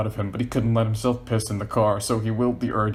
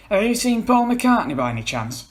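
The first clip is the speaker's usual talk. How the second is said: John calling; 12 semitones higher, 5 dB louder than usual. Acted. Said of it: "came a familiar voice".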